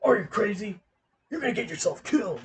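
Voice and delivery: a hint of Solid Snake warning a comrade off; Solid Snake impression. Imitating Solid Snake stopping someone, mildly voiced. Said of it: "He's like".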